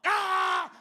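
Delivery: loud high scream; hammed-up loud shriek; butt scream. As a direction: scream out as the pain hits. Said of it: "your ass is like".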